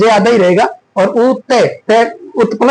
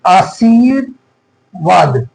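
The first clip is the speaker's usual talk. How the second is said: आशीर्वाद slowly, as "aa-shiir... vaad"; slow pronunciation demo; 2 semitones lower, much slower than usual. Shown as a demonstration. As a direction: stretch the long ee, pause before "vaad" in आशीर्वाद. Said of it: "कैसे बोलते हैं".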